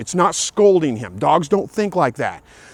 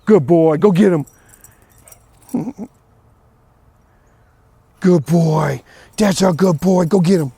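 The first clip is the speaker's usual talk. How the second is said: cheering the dog; 4 dB louder than usual. Real, not acted.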